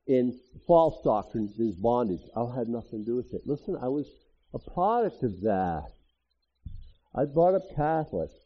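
normal talking voice

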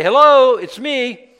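loud, high, grand call from the heavens; booming God voice. Pretend. Preacher voicing God from the clouds, loud and grand.

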